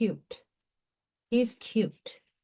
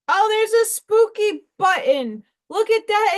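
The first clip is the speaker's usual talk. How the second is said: high and louder, delighted at a find; excited; 10 semitones higher, 5 dB louder than usual. Real, not acted. Crafter spotting a spooky button, delighted and excited. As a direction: squeal with glee over the spooky button.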